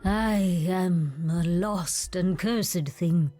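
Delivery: very slow, low lament; low, heavy resignation; despairing. Acted. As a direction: drag each word out in self-loathing. Monster despairs of itself.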